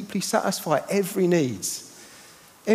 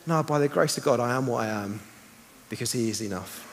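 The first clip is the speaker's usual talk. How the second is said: soft, low and tender; hushed; 8 semitones lower, 7 dB quieter than usual. Acted. Preacher softly reassuring the congregation, hushed. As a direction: let it sink in, hushed and low. Soft climactic gospel declaration.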